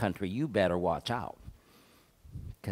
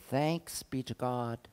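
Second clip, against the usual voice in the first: ordinary delivery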